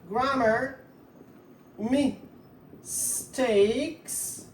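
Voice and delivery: word by word with long pauses; slow dictation. Shown as a demonstration. Teacher writing the sentence out for students, slow dictation. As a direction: dictate it in pieces, waiting between words.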